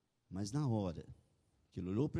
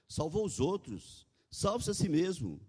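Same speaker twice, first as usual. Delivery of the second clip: higher-pitched, lightly taunting quote; mild ridicule; 7 semitones higher than usual. A narrator's reading. Authorities ridiculing Jesus on the cross, mildly taunting. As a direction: read it as a restrained jeer. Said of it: "as autoridades ridicularizavam".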